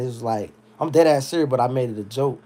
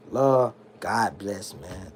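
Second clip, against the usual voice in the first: normal talking voice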